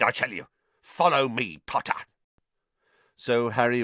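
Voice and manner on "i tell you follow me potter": irate caretaker giving an order; angry shouting. A narrator's reading. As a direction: order the culprit along crossly. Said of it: "he shouted".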